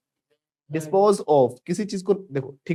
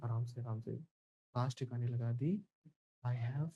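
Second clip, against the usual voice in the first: very soft, low, furtive murmur; hushed secrecy; 3 semitones lower, 14 dB quieter, slower than usual. Pretend. Accomplice reporting the body is disposed of, hushed and low. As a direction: keep it secret, barely voiced.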